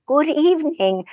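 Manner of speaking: mock-British evening greeting; British accent. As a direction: put on a British accent for the doorman. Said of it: "Totally British accent".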